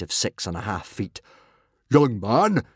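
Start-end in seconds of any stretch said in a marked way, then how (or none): 1.9-2.6 restrained roar, slightly raised. A narrator's reading. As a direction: chieftain calling a youngster to order. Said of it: "he roared".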